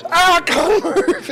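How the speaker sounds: put-on high, slow whiny kid voice; toddler voice. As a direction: play the stuck toddler. Toddler voiced in car seat.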